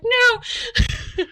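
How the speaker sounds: very high, loud stop; sharp no. Retold or imitated. Midwife stopping mother pulling baby, sharp high no. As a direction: cut her off with a high sharp no.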